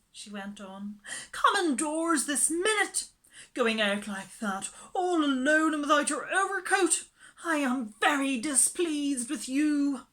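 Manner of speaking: high, loud fussy scolding. Acted. Miss Lark scolding Andrew for going out alone. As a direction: tell the dog off like a fretful mistress.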